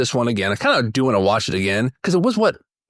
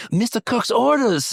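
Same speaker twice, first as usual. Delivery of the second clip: mock accent; 9 semitones higher, slower than usual. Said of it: "it was like".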